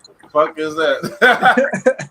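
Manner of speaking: loud, drawn-out question through laughter; amused disbelief. Real, not acted. Host laughing at co-host's pink runts, amused disbelief.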